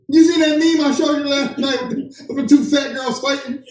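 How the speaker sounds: high-pitched, eager imitation of meme-sharing; mock eagerness. Husband telling wife about a meme, mock eager.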